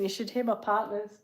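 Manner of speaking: normal talking voice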